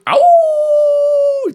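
high howling dog; long high dog howl. Retold or imitated.

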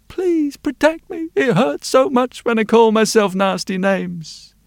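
put-on a hurting child begging; pleading little girl. Girl begging blacksmith to protect her from herself, high plea.

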